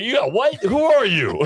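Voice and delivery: retold stunned dad, breaking into laughter; incredulous. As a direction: squeal it as if facing a stranger.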